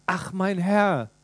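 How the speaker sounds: slow, drawn-out „Ach“ of a weary man; sighing Gideon. Acted. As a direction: sigh it out like a let-down farmer. (langsam seufzend, enttäuscht)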